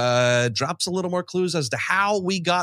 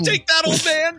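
excited cheer. Real, not acted. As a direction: cheer like a fan at a kill. Cheering at trailer kill.

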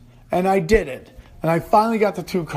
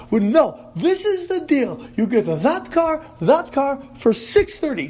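no bullshit salesman; 8 semitones higher than usual. Retold or imitated. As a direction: bark out terms like a blunt car dealer. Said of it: "he's just no bullshit guy".

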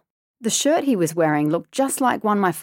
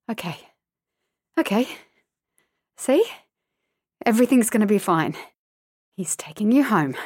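hushed relief; 3 semitones higher than usual. A narrator's reading. Relieved inner self-talk.

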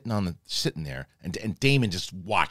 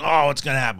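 mock anxious anticipation, pitched up; higher, lightly acted worry; imitated suspense; 6 semitones higher, 5 dB louder, faster than usual. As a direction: voice a viewer on edge. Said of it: "everybody's on the edge of their seat".

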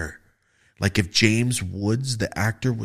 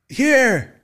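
loud answer; 14 semitones higher, 7 dB louder than usual. Retold or imitated. Answering name call in court.

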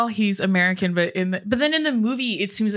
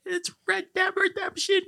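mock know-it-all; 12 semitones higher, 4 dB quieter than usual. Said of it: "Everyone's gonna be like".

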